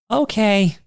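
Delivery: put-on high-pitched little-kid okay; put-on small girl's voice. Little girl's reply to Todd's warning.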